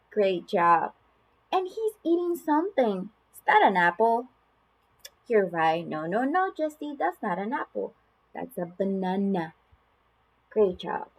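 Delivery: sing-song, exaggerated high swoops with stretched words; animated picture-book reading with big gasps; reading to children. Shown as a demonstration. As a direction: play it up for a toddler, full of wonder. Reads a lift-the-flap book to a child.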